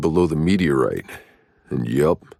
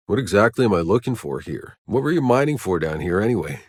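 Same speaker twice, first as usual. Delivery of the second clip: normal talking voice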